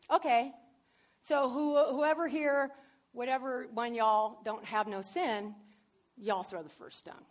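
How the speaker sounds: hammed-up Texas Jesus; Texas version. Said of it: "That was a Texas version".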